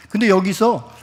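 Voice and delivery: ordinary talk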